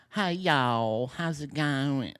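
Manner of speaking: drawn-out, performative Southern twang; put-on Southern twang. Comedian imitating her performative greeting, slow Southern twang. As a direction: stretch the greeting into a Southern drawl. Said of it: "throw that little twang on it".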